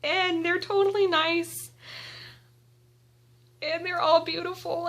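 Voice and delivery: usual speaking voice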